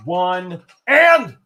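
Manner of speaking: building countdown to a big call; auctioneer. Real, not acted. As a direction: count it down, then belt the sold.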